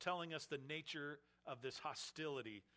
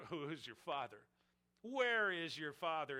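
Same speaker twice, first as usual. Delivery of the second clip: a jeering, louder challenge on where; mocking taunt; 5 dB louder than usual. Leaders taunting Jesus about his father, mocking jeer.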